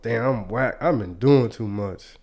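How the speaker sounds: ordinary talk, nothing marked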